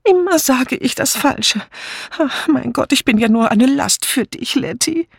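high, nervous self-reproach; unhappy cry. A narrator's reading. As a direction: wail it mildly in a high, fretful voice. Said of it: "blickte unglücklich drein und rief schließlich". (unglücklich klagend, hohe Stimme)

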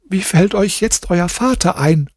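weeping wife. A narrator's reading. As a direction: ask through her grief. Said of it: "sie fragte".

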